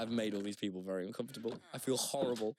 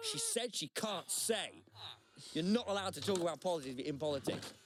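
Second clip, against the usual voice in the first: retold scolding; 7 semitones higher than usual. Girl's mate warning off a nosy stranger, mock scolding. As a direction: imitate an indignant friend stepping in.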